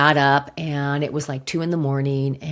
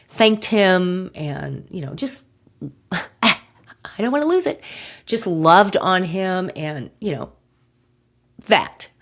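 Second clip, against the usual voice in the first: choking up; 4 semitones higher than usual. Real, not acted. Telling of her dog's last night, choked up. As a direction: voice catching, fighting back tears. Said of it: "Give me a second".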